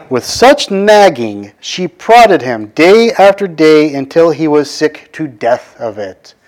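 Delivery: very slow, weighty reading of the verse; emphatic scripture reading. Shown as a demonstration. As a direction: lean on each phrase of the key words. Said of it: "here's the key words".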